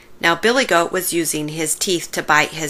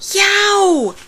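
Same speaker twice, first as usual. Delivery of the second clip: sharp yelp far higher; high pained cry; 12 semitones higher, 4 dB louder than usual. Acted. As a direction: yelp as if just bitten. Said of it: "she cried".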